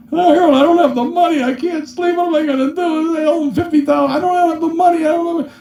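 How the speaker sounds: high, fast panicked pleading; panicked whine. Retold or imitated. Client calling Harold at five, panicked whine. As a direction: wail like a man who can't sleep.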